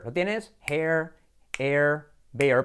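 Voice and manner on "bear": ordinary delivery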